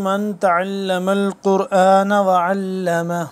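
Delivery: slow chanted Arabic recitation. Acted. Teacher recites hadith to open the lesson, chanted. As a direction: intone the hadith slowly and reverently.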